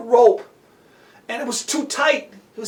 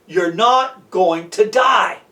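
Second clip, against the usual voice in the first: slow, low, steady promise; calm a panicking friend, word by word; firm reassurance; 5 semitones lower, slower than usual. Acted. Rescuer calmly reassures terrified boy.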